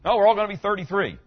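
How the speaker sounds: gently mocking what people say; a chirpy, mock-cheerful take on "all gonna be thirty-three"; mock gushing quote. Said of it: "Anybody ever heard that?".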